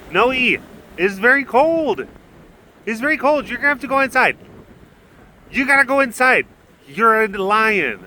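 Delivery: high, coaxing voice for a big cat; high sing-song talk to the lion about the cold; pet talk. Real, not acted.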